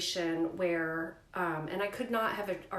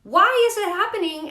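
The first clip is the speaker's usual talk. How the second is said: much higher and louder indignation; indignant retort; 11 semitones higher, 12 dB louder than usual. Retold or imitated. Mother arguing against removing her son's gallbladder, loud indignation. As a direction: defend the gallbladder with fervor.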